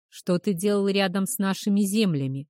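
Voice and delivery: ordinary delivery, nothing marked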